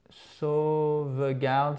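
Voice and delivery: very slow, stretched-out SAUVEGARDE; slow dictation. Shown as a demonstration. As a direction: draw out each syllable of SAUVEGARDE. Spells out a word slowly.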